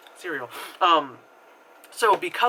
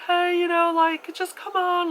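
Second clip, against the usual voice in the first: trying to be nice; 14 semitones higher than usual. Retold or imitated. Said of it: "he's trying to be nice".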